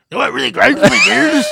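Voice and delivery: high, loud, hammed-up rant opener; shouted catchphrase. Redoing a friend's segment line as a big mock shout. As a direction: bellow it like a TV rant host. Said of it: "That's Will's segment".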